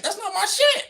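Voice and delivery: "that's not my shit" flung up high, ending in a rise; high mocking take. Repeating the line two ways.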